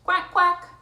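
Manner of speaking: high, loud duck call; little duck quack. Acted. Reader voicing the lost duckling for children, high quack. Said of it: "quack like the little duck".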